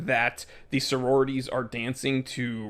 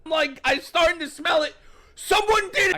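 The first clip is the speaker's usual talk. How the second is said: high-pitched, loud mock alarm; yelly; 4 dB louder than usual. Comic resuming his smell bit, high and yelly. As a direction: yell it up high as if horrified. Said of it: "a little fixated and yelly".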